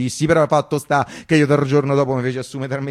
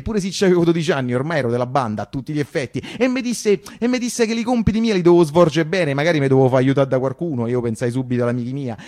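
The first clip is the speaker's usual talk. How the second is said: ordinary delivery, nothing marked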